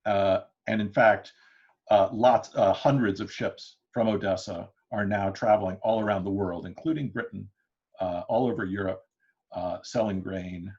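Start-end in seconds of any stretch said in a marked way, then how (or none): none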